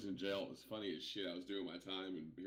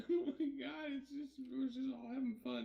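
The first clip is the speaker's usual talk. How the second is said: laughing disbelief. Retold or imitated. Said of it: "I'm like".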